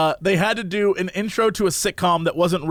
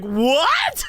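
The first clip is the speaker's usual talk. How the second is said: a laughing shouted "what"; laughing incredulous shout; shocked laugh; 9 semitones higher than usual. Retold or imitated. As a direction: burst out in disbelief. Shocked reaction to the twist.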